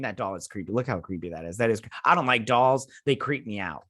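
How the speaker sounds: normal talking voice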